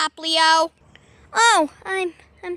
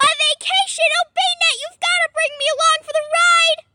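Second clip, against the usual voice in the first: excited creature squeal; 12 semitones higher, 3 dB louder, faster than usual. Acted. Poplio begs to come.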